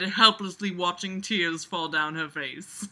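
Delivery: ordinary delivery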